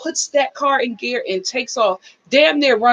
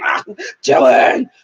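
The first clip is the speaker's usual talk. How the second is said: mock frantic yell; 4 semitones lower, 5 dB louder, slower than usual. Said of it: "still going".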